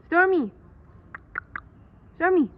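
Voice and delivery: high, loud falling calls; calling out loud. Real, not acted. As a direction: call her name down to the yard. Calling the cat.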